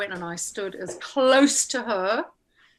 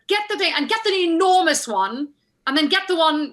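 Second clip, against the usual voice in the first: loud, high, imperious orders; mock shouting; 6 semitones higher, 5 dB louder than usual. Costumier shouting at her assistant, impatient. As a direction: imitate calling orders to someone across a warehouse. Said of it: "shouting at her assistant".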